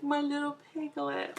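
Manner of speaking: put-on high, cutesy coo at a baby; cooing baby talk. Mom cooing over her hoarse baby, high cutesy baby talk. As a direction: coo it like pet-talk to a tiny piglet. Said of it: "I was like".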